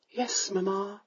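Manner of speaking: soft, resigned daughter; hushed dutiful reply; meek yes. A narrator's reading. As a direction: give in quietly. Daughter submits.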